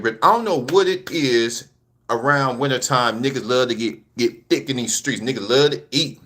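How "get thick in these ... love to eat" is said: ordinary talk, nothing marked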